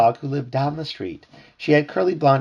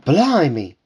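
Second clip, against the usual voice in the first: louder, higher burst of amazement; startled exclamation; 5 semitones higher, 6 dB louder than usual. A narrator's reading. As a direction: exclaim it like an amazed story character. British-accented Delilah amazed in story.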